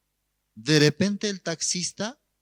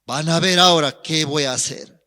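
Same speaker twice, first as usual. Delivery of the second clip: threatening; 3 dB louder than usual. Retold or imitated. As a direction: vow revenge. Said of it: "Amenazó".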